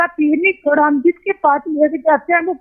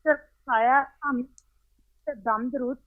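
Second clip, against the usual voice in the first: bad connection; 3 semitones lower, 9 dB quieter than usual. Real, not acted. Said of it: "آپ کی آواز کٹ رہی ہے".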